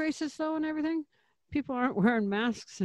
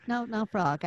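Bart Simpson voice. Pretend. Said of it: "I got Bart".

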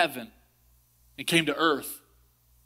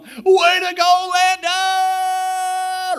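high, slow, loud cheer from the bleachers; dad cheering; 8 dB louder, much slower than usual. Retold or imitated. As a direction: yell it from the top of the stands. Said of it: "my dad ... going".

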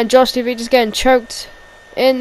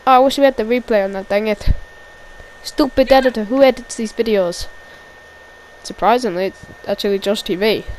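normal talking voice

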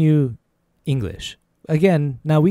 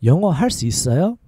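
flowing, syllables run together; a little faster; much faster than usual. Shown as a demonstration. Teacher modelling an English question for learners, a little faster. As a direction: ask it at conversational speed. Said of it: "A little faster".

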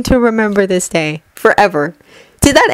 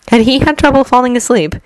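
ordinary delivery

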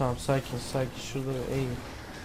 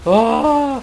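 high loud scream of surprise; panicked gamer scream; startled scream; 8 semitones higher, 9 dB louder than usual. Real, not acted. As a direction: shriek as the enemy jumps you. Ambushed in a firefight.

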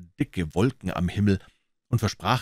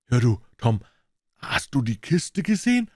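put-on high, louder eager boy; 6 semitones higher, 4 dB louder than usual. Huck asking Tom about the box, eager boyish voice. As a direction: ask eagerly.

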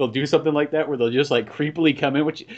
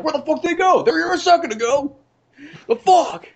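high, loud comic bewilderment; outraged baffled T-Rex; 6 dB louder than usual. Acted. T-Rex has lost sight of its prey, outraged shouting. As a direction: shout in high-pitched cartoon disbelief.

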